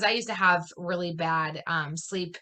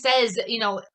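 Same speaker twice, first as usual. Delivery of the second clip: ordinary talk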